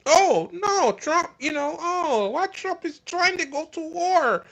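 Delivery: high, whiny mock-surprised voice; mocking MAGA fan. Host mocking shocked MAGA fans over the Iran war, whiny high falsetto. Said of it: "maga acted so su-, fucking surprised that".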